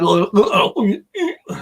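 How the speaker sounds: high, loud garbled Scottish gibberish; strong Scottish accent. Retold or imitated. Imitating the Scottish chap's baffling last word, mock Scottish accent. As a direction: mimic unintelligible Scottish mumbo-jumbo. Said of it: "a chap with a very strong Scotch accent".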